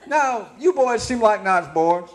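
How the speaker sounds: put-on high, loud Southern old-timer voice; old campground proprietor. Proprietor taking to the boys, high Southern old-timer voice. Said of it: "now I said".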